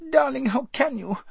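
put-on very high squeaky girl; a spoiled girl squeaking in protest; squeaked. A narrator's reading. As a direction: squeak it out in dismay. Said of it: "squeaked Lazareline".

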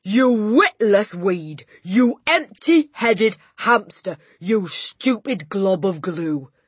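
slow, high, furious bellow; bellowing Trunchbull. A narrator's reading. Headmistress abusing a small boy, slow furious bellow. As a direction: hurl each insult slowly and very loudly. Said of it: "the Trunchbull bellowed".

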